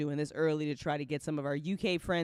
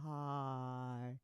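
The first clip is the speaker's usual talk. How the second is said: normal talking voice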